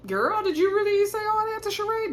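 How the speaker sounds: high, playful gossip tone; casual girlfriend voice. Retold or imitated. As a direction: play it like a nosy girlfriend asking lightly. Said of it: "They're just kinda like".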